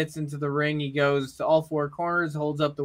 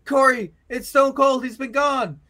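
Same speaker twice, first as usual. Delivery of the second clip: much higher, louder, astonished call; excited commentator; 7 semitones higher, 5 dB louder than usual. Acted. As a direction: lose it like a stunned ringside announcer. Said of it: "then Tony Schiavone says".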